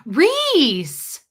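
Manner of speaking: embarrassed scold. Retold or imitated. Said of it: "my mom will look at me and go".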